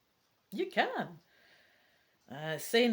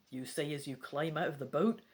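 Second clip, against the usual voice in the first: ordinary delivery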